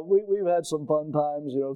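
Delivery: ordinary talk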